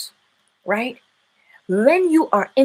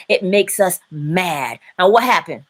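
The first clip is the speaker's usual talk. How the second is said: ordinary talk, nothing marked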